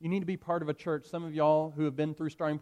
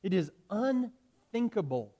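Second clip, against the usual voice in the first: slow firm refusal; 5 semitones higher, slower than usual. Acted. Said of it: "Polycarp replied".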